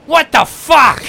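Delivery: high, loud shout of disbelief; hammed-up furious outburst; mock outraged yell. Imitating dad catching the impression, outraged shout.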